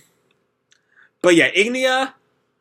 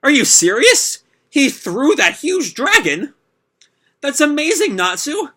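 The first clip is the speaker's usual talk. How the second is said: much higher, astonished exclamation; amazed cry; 11 semitones higher, 4 dB louder than usual. Acted. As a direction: gasp it out in disbelief. Onlookers amazed at Natsu.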